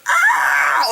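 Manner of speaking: high shouted scream; a sudden shriek from offscreen. Retold or imitated. Victim screaming in the parking lot, loud shriek. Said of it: "you hear".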